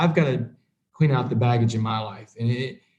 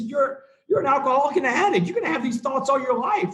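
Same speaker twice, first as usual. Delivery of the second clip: laughing through the words, voice pitched higher; laughing sponsor; 11 semitones higher than usual. Retold or imitated. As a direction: imitate the sponsor chuckling as he explains. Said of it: "He starts laughing at me".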